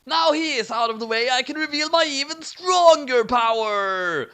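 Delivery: high shrieking gloat; screaming. A narrator's reading. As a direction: crow it triumphantly. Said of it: "Dust screamed".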